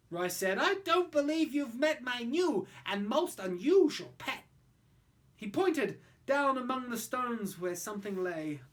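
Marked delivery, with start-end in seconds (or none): 0.6-4.4 put-on boastful boy. Roy shows off pet.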